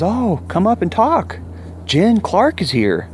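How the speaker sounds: high, slow cheerful call; lightly voiced friend calling up the stairs; friendly call upstairs. [name] calling him upstairs.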